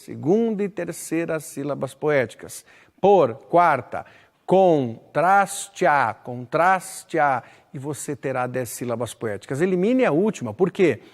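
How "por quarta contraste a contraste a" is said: very slow, each syllable separated; counting syllables. Shown as a demonstration. Teacher counts poetic syllables aloud, slowly. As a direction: drag out "contraste" syllable by syllable. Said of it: "primeira sílaba poética".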